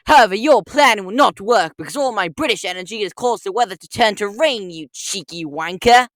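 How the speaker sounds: put-on British accent, taunting the Lorax. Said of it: "British Walrus Detective".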